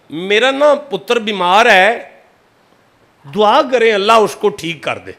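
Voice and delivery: disguised voice. Retold or imitated. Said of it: "بڑی آواز بدل کے".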